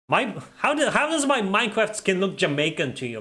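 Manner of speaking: high-pitched, playfully affronted protest; mock indignation. Real, not acted. Streamer answering a viewer about his Minecraft skin, mock indignant. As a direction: protest in high, exaggerated disbelief.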